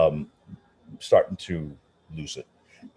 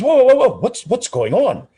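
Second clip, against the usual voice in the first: alarmed shout; 9 dB louder than usual. Retold or imitated. Maestro crying out as his piano is carried off, loud and alarmed. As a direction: panic as the piano leaves.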